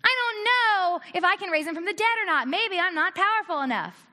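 loud, high-pitched mock panic; exaggerated distraught cry, acted out. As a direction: wail it in hammed-up worry. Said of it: "crying because he went".

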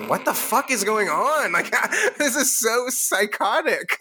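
high laughing disbelief. Real, not acted. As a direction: laugh through it, baffled.

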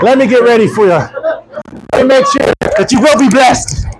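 mock holy proclamation. Preacher presenting oil to people in India, mock proclamation. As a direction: proclaim it grandly to a crowd.

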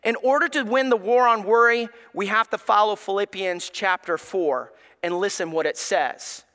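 ordinary talk

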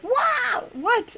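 high-pitched startled exclamation; startled alarm. Retold or imitated. Friends in the car reacting to her gasp, startled. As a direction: imitate friends jolted by a gasp.

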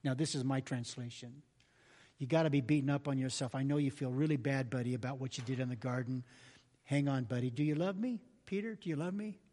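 low, soft, tender comfort; Jesus comforting Peter after the denial; gentle reassurance. Pretend. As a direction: gently lift up a broken friend. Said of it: "Jesus looks at Peter and says".

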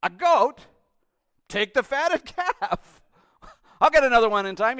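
loving father laughing at a goat request; generous father. Acted. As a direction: brush it off with big-hearted joy. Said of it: "the answer would have been".

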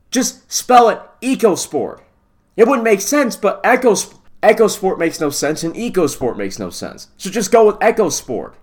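high, loud frustrated pleading; exasperated rant. Real, not acted. Host arguing with the carmaker over the name, exasperated. Rant at spelling versus pronunciation.

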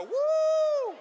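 very loud, high "woo"; whooping cheer. Shown as a demonstration. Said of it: "Just go".

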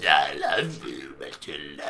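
sludgy honey monster. Pretend. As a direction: drag it out deep and gooey. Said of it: "Go again".

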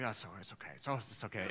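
deflated man mumbling it's okay; disarmed driver. Retold or imitated. Driver deflated by wife's apology, low sheepish mumble.

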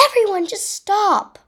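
high, loud plea to stop; exasperated high-pitched shout; crying out. A narrator's reading. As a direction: cry out over the squabbling. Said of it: "I cried".

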